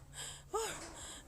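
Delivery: high, audible sigh; exaggerated procrastinator's sigh; mock weary sigh. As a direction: sigh as you put it off. Retelling putting off the start.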